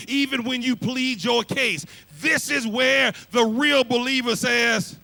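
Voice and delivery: loud, rising preacher's build; preaching at full force. Acted. Preacher building toward the congregation, loud and rising. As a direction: climb to full-throated preaching.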